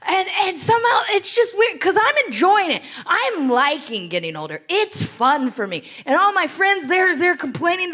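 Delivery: ordinary talk, nothing marked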